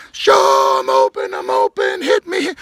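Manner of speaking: loud, high shouts for the ball; screaming. Retold or imitated. Teammate screaming for the ball on his route. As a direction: yell that you're wide open. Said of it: "runs his whole route screaming".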